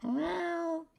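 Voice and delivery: high squeaky meowing; cat meow. Pretend. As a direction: meow back at the cat like a kitten.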